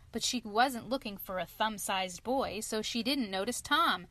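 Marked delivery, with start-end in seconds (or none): none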